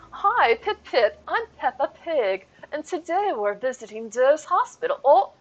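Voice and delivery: squeaky British cartoon pig, hammed up; Peppa Pig British accent. Streamer doing Peppa Pig for a viewer's daughter, mock British accent. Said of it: "talk in a British accent".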